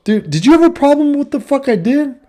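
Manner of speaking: high, pointed demand; confrontational challenge. Retold or imitated. Confronting his friend at the golf store, challenging him. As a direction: call him out to his face. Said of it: "I went up to him and I said".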